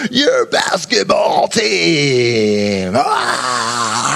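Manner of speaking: slow drawn-out pep rally cheer, then a cry; mock cheer. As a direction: cheer the team like a crowd in the gym.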